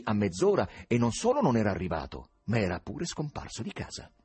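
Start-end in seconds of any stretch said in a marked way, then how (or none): none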